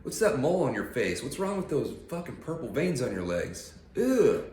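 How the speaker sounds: high, loud hammed-up disgust; mock a rude gawker with big ughs; mock disgusted critic. Pretending to insult a grandma, mock disgust. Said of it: "be like".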